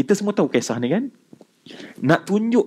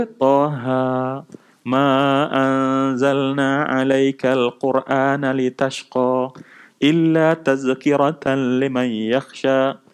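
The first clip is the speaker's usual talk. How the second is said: slow, melodic chanted recitation; Quran recitation; slower than usual. Acted. Preacher recites Surah Taha to the congregation, chanted slowly. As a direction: draw out each verse in tajwid melody.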